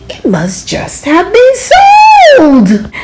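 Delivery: high drawn-out dismay. A narrator's reading. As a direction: stretch it with sinking dismay. Dismay at thrift store.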